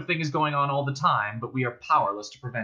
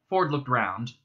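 ordinary delivery

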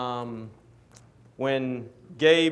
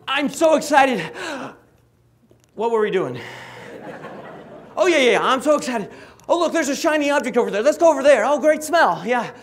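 loud, very high hyperactive dog voice; really, really excited; 12 semitones higher, 8 dB louder, faster than usual. Acted. Young dog chasing shiny objects and smells, wildly excited. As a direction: burst out high and loud, distracted by everything. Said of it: "his theme song is more like this".

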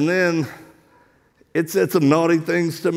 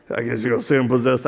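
low, fast recap of the demon; imitated demon voice; 6 semitones lower, much faster than usual. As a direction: lightly mimic the demon, low and quick. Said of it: "this demon speaks out".

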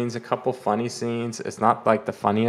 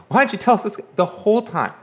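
exasperated mock demand; 10 semitones higher, 4 dB louder, faster than usual. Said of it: "they're like".

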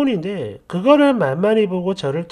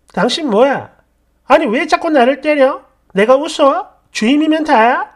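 angry, raised, higher-pitched confrontation; indignant volley of loud questions; yelling back; 5 semitones higher, 5 dB louder than usual. Acted. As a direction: shout back at a bullying boss. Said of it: "저도 같이 질렀죠".

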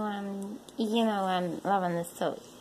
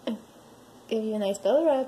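normal talking voice

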